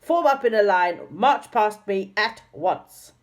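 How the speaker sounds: loud order. A narrator's reading. Dame Snap ordering angrily.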